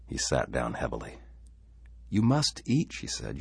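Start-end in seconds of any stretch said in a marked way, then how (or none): 2.1-2.9 high-pitched, concerned urging; urging wife. Acted. Wife to weakened husband, gently insistent. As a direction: lift the voice high and press him.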